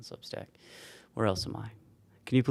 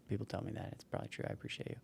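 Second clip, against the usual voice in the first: whisper; 13 dB quieter, faster than usual. Real, not acted. Quiet self-deprecating aside.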